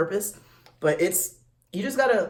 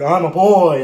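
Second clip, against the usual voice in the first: loud firm correction; insistent correction; 10 dB louder than usual. Retold or imitated. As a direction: hit it hard to correct them. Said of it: "You're like".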